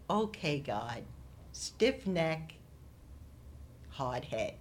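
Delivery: soft, understated word to God; retold resigned prayer. Speaker recounts talking to God after falling, quiet and resigned. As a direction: quietly admit it to God, with pauses.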